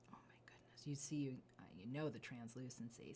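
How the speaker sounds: hushed gasp of delight; breathless admiring whisper; awed whisper. Real, not acted. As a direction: whisper as if stunned by beauty.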